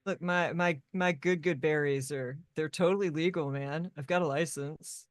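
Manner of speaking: stoner surfer, trailing down low; Brandon. Acted. Surfer character defends his berries, mellow stoner voice. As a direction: lazily protest like a stoned surfer. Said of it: "stoner meets surfer boy".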